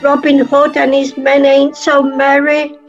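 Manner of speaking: normal talking voice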